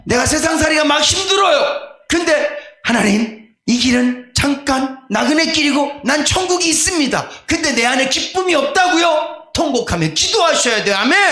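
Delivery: high, loud exhortation; preaching at full force; fiery preaching. Acted. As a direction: shout the call to weep and pray. Exhorting to lament in prayer.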